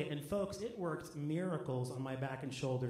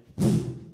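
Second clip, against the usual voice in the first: mock strained grunt; 12 dB louder than usual. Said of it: "and I went".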